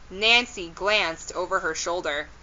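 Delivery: plain reading voice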